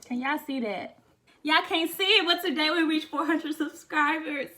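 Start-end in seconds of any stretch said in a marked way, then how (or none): 1.4-4.5 louder, higher excited announcement. Real, not acted. Vlogger telling viewers about four hundred subscribers, excited. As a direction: burst out with the good news. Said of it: "I am so excited".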